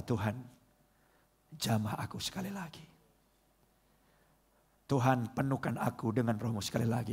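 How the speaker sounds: low, soft plea to God; quiet, reverent appeal; earnest prayer. Real, not acted. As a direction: lead a tender, hushed prayer. Leads congregation in closing prayer.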